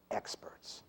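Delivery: "experts" set apart, hushed and nearly whispered; soft, breathed "experts" as the key word; emphasis after pause. Shown as a demonstration. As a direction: land "experts" softly after a held silence. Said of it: "the pause lets you give emphasis".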